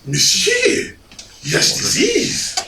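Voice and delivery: high, slow French waiter insistence; pompous French accent. Retold or imitated. French waiter contradicting a diner, pompous accent. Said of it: "in his pompous accent".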